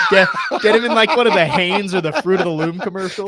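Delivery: laughing. Real, not acted. Host laughing while pitching underwear commercials. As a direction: talk through laughter about commercials.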